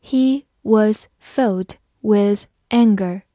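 slow, careful sentence reading. Shown as a demonstration. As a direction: read each word slowly and clearly. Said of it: "好，慢慢来读".

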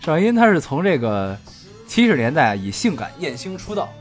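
loud, emphatic declaration; forceful. Real, not acted. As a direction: say it ringing and forceful. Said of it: "你能不说这么铿锵有力吗".

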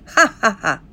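a big booming ha ha ha; the boss's hearty laugh. Acted.

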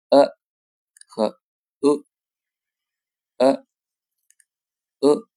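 呃 said short and lax in turn; vowel contrast demo. Shown as a demonstration. Teacher contrasting two similar vowels. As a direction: model each 呃 cleanly for comparison. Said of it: "咱听一下这个区别".

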